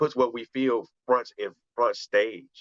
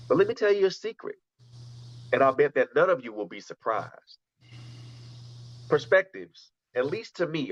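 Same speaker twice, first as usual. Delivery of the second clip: measured poetry recital, pitched up, with pauses between phrases; spoken-word poem; 4 semitones higher than usual. Acted. Poet reciting "Perspective" from his book to the group, spoken-word recital. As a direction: recite it line by line, letting each phrase land.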